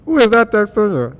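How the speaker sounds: put-on shouted, high Nigerian-accented interrogation; mock-outraged Nigerian pastor, very loud; old Nigerian pastor. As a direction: call someone out from the pulpit in Nigerian accent. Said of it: "this is how my old pastor used to talk".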